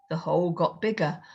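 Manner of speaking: usual speaking voice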